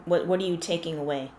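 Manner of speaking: usual speaking voice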